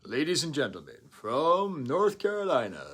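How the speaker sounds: Bulls arena announcer. Retold or imitated. Recalling the Chicago Bulls intro, booming announcer. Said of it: "very famous opening song".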